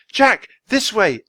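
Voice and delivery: high, loud, slow call over the wind; desperate call through the blizzard. A narrator's reading. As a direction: shout to be heard above a roaring storm. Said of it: "Annie's voice called from above the roar".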